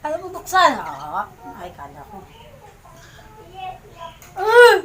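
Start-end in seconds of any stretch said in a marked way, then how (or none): 4.3-4.8 shriek. Real, not acted. Opening the sauce.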